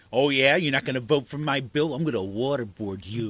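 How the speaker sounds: lightly voiced menacing politician; mock Saccone threat. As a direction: sneer it out as a cartoon bully lawmaker. Mocking Saccone threatening a colleague.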